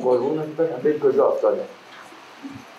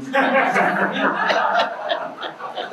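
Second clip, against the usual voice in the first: high laugh; 12 semitones higher than usual. Real, not acted. Laughs at his own punchline.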